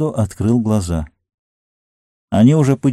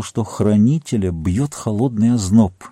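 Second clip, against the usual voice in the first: ordinary delivery, nothing marked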